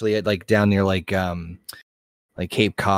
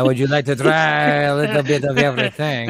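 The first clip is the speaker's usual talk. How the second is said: put-on French accent. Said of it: "In a French accent".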